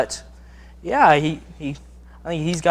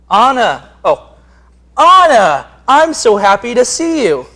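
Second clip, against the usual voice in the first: higher, louder joyful Elsa; 8 semitones higher, 9 dB louder than usual. Acted. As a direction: greet your sister like Elsa. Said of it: "Elsa enters".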